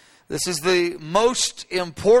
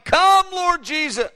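high, slow cry; impassioned pleading cry; 11 semitones higher, 4 dB louder, slower than usual. Retold or imitated. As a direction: lift it up slowly as a plea. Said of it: "The bride's crying".

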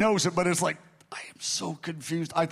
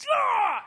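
sudden piercing high scream, much louder; sudden cry; 5 dB louder than usual. Retold or imitated. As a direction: let out a shocked high cry as if struck. Woman struck during prayer.

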